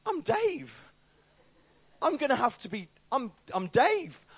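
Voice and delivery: high, panicked self-talk; inner panic. Retold or imitated. Realizing he must now be Dave, high panic.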